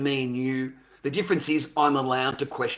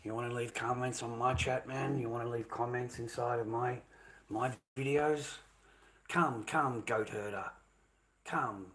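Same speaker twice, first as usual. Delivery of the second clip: low, much softer menacing taunt; softly bait the troll to come out; quiet taunting; 3 semitones lower, 14 dB quieter than usual. Real, not acted. Streamer goading a troll in his chat, quiet menace.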